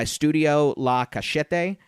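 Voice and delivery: name said slowly with a Spanish accent. Shown as a demonstration. Saying a French studio's name with a Spanish accent. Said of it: "I put a Spanish accent on it".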